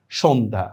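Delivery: no y in সন্ধ্যা; silent j-phala. Shown as a demonstration. Teacher repeats the example, সন্ধ্যা with silent y. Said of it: "জফলা কোনো উচ্চারণই আমরা করি না".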